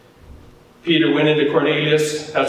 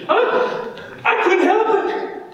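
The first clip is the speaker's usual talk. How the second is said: very high-pitched protest; hammed-up helplessness; mock helpless excuse; 13 semitones higher than usual. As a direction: plead innocence up in a squeaky register. Replays the lame excuse.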